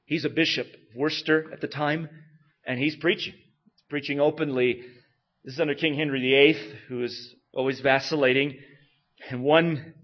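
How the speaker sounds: normal talking voice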